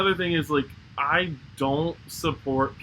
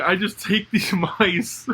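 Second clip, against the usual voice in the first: laughing through a quote; 5 semitones higher, 3 dB louder, slower than usual. Retold or imitated. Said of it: "he's like".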